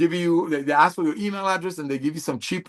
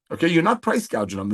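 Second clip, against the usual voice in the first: ordinary talk, nothing marked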